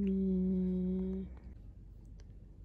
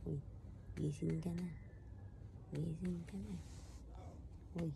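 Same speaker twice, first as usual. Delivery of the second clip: soft waking voice; 2 semitones lower, 16 dB quieter, much faster than usual. Real, not acted. Gently waking the baby.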